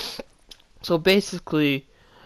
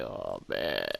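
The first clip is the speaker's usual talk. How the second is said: defeated mutter; 10 dB quieter than usual. Retold or imitated. Defeated sigh, very low.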